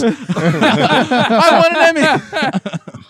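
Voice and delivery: long drawn-out laughing whine; whiny spoiled pouty drawl. Pretend. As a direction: stretch it out slowly in a whine. Repeats plea, laughing.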